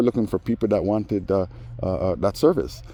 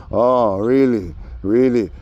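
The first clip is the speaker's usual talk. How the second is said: slow, loud, higher "oh really" with sarcasm; mock indignation; 4 semitones higher, 6 dB louder, slower than usual. To the man hollering at him, sarcastic loud oh really.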